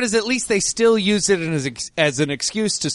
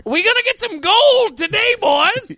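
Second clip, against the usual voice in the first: put-on old prospector. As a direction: holler like a grizzled gold miner. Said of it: "old prospector level".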